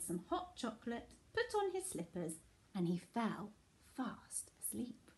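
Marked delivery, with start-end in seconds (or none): 3.9-5.1 slow soft whisper. A narrator's reading. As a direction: hush as he falls asleep. Story winding down sleepily.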